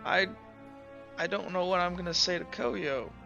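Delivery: soft, halting and mildly upset; quietly lost for words; sniffling, understated. Acted. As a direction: hesitate as if holding back tears. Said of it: "Kind of just sniffles".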